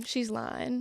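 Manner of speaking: quiet demo of a Southern "lying", drawn out as "lahn"; soft coaching demo of Southern "lying". Shown as a demonstration. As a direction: show how a Southerner says "lying", like "lahn". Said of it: "you'd have to say lying".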